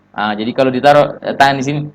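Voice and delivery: ordinary delivery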